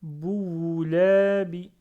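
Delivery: Bououlabi read slowly, the ou sound drawn out and loud; prolonged waw. Shown as a demonstration. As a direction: stretch the ou in Bououlabi. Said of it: "Là, le waouh, il faut le prononcer".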